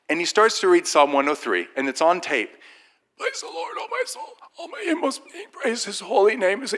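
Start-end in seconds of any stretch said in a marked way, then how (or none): 3.2-6.7 imitating the virus voice. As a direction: read it squeezed up high like his damaged voice. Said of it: "he talked like this his whole life".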